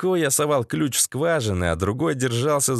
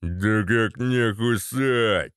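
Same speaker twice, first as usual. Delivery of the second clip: very slow, drawn-out lout voice; put-on sluggish bruiser voice; slow thug; 3 semitones lower, much slower than usual. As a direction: drag every vowel like a dim thug.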